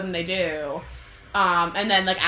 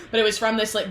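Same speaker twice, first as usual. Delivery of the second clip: usual speaking voice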